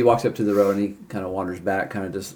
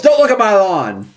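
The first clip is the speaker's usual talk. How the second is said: high, loud cranky holler; yelling; 11 semitones higher, 7 dB louder than usual. Acted. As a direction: shout it at someone staring at your yard. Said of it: "And then he yells".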